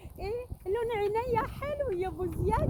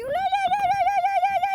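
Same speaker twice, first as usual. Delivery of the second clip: loud, much higher cries of no; shouting no; 10 dB louder, faster than usual. Real, not acted. Shrieking no at the woman's self-wedding. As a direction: yell no over and over in disbelief.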